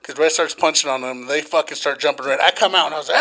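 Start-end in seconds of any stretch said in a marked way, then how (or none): none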